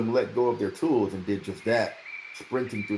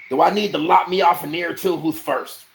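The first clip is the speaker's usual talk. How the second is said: loud, higher bullying threat; menacing taunt; 8 semitones higher, 7 dB louder than usual. A narrator's reading. Bennis bullying the diggers, loud and menacing. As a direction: lean over them and threaten.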